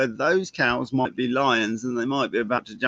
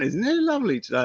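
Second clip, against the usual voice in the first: happy walker; 7 semitones higher, much faster than usual. Retold or imitated. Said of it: "going along quite happily".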